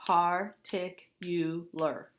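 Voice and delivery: very slow, four syllables pulled apart. Shown as a demonstration. Teacher counts four syllables aloud, very slowly. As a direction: stretch each syllable for the kids. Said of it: "a whopping four syllables".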